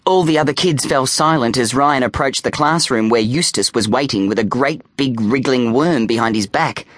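ordinary delivery

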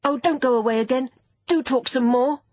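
put-on fast, high, eager boy; pleading cry. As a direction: beg it urgently to stay. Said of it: "Robert cried".

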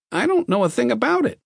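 very high, anxious denial; nervous man. A narrator's reading. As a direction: deny it timidly, pitched right up. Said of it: "stood up nervously".

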